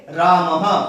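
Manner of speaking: "रामः" with a light breathy ह at the end; visarga demo. Shown as a demonstration. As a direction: end "रामः" on a half-length ह. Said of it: "क्या उच्चारण करेंगे". ("रामः" में आधा ह)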